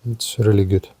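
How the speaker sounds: ordinary talk, nothing marked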